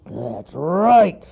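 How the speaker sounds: loud, high-strained growl; menacing storybook bear; bear's growl. A narrator's reading. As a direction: growl it as a gotcha. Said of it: "growled the bear".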